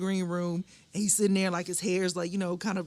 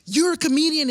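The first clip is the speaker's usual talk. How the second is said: stern stranger in the car; stern man; 10 semitones higher, 8 dB louder than usual. Retold or imitated. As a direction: bark it like a suspicious stranger. Man accusing, retold in car.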